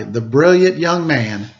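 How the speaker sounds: usual speaking voice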